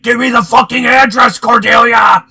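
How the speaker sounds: loud, high, mock-furious yell; shouted demand. Demanding an address over the phone, in a mock-furious shout. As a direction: imitate a caller losing it at full volume.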